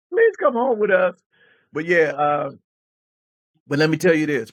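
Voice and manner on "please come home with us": put-on little boy pleading. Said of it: "He said".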